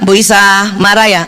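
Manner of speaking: low, measured, careful question; lightly voiced husband asking patiently; practised husband. Husband who has learned to ask his wife, low and measured. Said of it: "Udah pinter sekarang".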